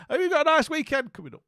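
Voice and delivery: one last quick, high Scottish sign-off; hammed-up Scottish farewell to Becca; Scottish accent. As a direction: rattle it off fast in Scottish before dropping it. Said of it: "I'll stop now".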